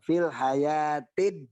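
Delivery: "Fil hayatid" with the doubled d held clearly; slow "hayatid", d held before dunya; tasydid sounded. Shown as a demonstration. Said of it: "Bunyikan tasdidnya".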